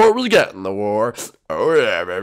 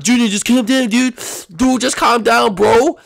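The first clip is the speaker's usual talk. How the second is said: loud, high, insistent; raised voice, urgently calming someone; urgent pleading; 11 semitones higher, 7 dB louder than usual. Acted. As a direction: try to talk down a panicking friend. Urging a panicking friend to calm.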